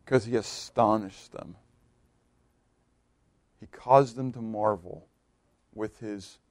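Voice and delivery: slow, very soft and low sermon close; low, hushed pulpit reverence with long pauses; hushed preaching. Real, not acted. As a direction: let each phrase sink in quietly.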